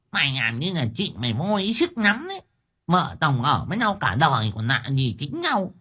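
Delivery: high-pitched, huffy wifely protest; acted peevish wife in a high voice; sulky reproach. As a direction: push back, put out at being scolded. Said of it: "Vùng vằng như có ý trách".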